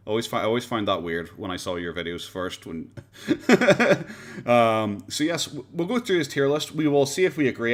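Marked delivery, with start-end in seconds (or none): 3.0-4.4 high burst of real laughter; laughing. Real, not acted. Streamer amused at fellow YouTuber's microphone, laughing. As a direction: break into a high, loud giggle.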